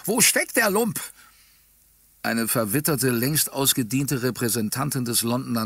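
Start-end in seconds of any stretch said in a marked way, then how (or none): 0.1-1.0 high, challenging cry; hammed-up fierce demand; bloodthirsty shout. A narrator's reading. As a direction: call the culprit out, hungry for blood. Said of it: "mit blutrünstig verzerrtem Gesicht nochmals ausgerufen".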